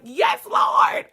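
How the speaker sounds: high, loud cry of praise; exultant shout. Retold or imitated. Reenacting thanks to God while in the water, exultant shout.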